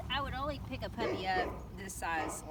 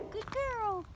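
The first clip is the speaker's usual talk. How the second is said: puppy praise; 12 semitones higher than usual. Real, not acted.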